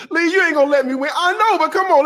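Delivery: high, quick mock pleading with laughter; laughing acted banter. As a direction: tease it out through a laugh. Acted flirting dialogue, laughing.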